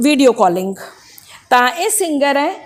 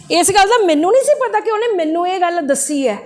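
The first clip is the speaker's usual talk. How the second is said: ordinary delivery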